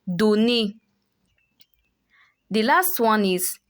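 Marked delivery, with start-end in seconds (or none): 0.1-0.7 "Donné" with E R said as ay; French é sound. Shown as a demonstration. Teacher repeats the word after the rule, careful French pronunciation. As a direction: say "Donné" ending in a closed é, no R. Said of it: "gives an A sound".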